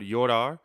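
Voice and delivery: "llorar" without a J, starting like "yor"; no J sound. Shown as a demonstration. Teacher showing an exception to the rule, no J sound. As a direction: say "llorar" as "yorar", not "jorar". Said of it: "does not produce the J sound".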